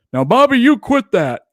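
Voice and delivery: loud, high mock parent call; Hank from the other room. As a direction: bark it across the house like a bothered dad. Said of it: "And Hank just goes".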